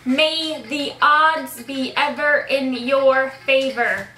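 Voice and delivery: slow, stretched, deliberate words; slow lip-reading prompt. Shown as a demonstration. Player giving a friend a film line to lip-read, slow and deliberate. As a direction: draw out each word for a lip-reader.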